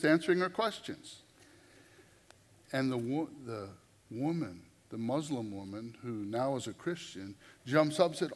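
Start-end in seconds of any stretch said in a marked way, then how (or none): none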